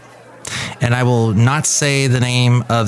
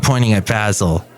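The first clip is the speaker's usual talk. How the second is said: ordinary delivery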